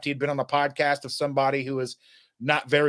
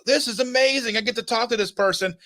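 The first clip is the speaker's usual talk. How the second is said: higher-pitched thrilled delight; eager, raised-pitch retelling; excited quote; 7 semitones higher than usual. Retold or imitated. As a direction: relive being thrilled at the chance. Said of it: "so I was like".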